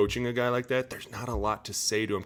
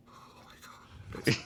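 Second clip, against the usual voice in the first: softer whispered awe, slow; scared whisper; 10 dB quieter, slower than usual. Retold or imitated. Lineman after being yelled at by coach, whispered dread. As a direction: breathe it out in quiet dread. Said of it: "you're like".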